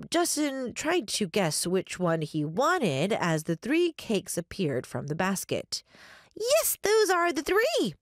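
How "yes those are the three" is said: put-on high, drawn-out little-girl cheer; excited yell. A narrator's reading. Child ghost confirming the three cakes, yelling excitedly. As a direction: cry it out high like a delighted child. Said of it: "Gretchen yelled excitedly".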